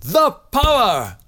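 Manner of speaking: loud high shout. Shown as a demonstration. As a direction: shout it out triumphantly. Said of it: "and then you shout".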